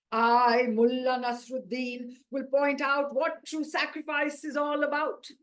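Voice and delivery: orate like a mullah on a dais; solemn preacher. Acted. Preacher's sermon.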